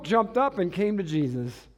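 ordinary talk

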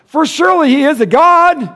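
high, loud mock reverence; mocking fake awe; sarcastic. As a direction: sneer it sarcastically. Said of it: "he scoffed".

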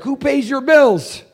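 a mad manager grilling him; angry boss demand. Retold or imitated. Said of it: "And man, he got mad".

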